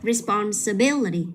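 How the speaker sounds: responsibility with BIL stressed, then falling; stress before the ending. Shown as a demonstration. Teacher repeating for a student, responsibility stressed on BIL. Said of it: "Nhấn vào".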